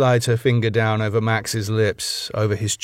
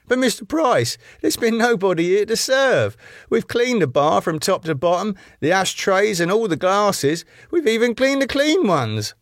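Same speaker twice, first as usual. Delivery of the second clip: very high, whining protest; protesting barman; 10 semitones higher than usual. A narrator's reading. Barman defends himself to Mister Price, protesting. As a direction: plead your case, pitched right up.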